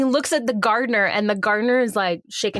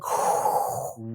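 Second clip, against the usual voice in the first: long, breathy exhale of disapproval; exasperated sigh; 5 dB quieter than usual. Retold or imitated. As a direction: let out a heavy sigh. Reacting to the boyfriend's line.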